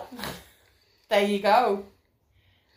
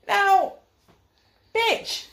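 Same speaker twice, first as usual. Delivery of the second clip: usual speaking voice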